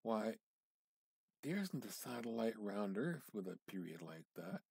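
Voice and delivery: ordinary delivery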